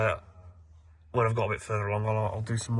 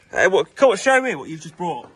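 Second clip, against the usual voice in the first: excited teasing call; 7 semitones higher, 4 dB louder than usual. Real, not acted. Teasing friend who brought lunch.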